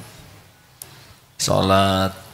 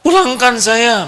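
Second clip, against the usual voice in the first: high, loud, slow wailing plea; dramatic wife's demand; 8 dB louder, slower than usual. Acted. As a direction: wail it like a sulking wife. Wife demands to go home. (istri merajuk minta dipulangkan)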